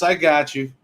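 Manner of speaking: ordinary talk, nothing marked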